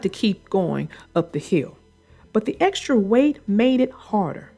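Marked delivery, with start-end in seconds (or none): none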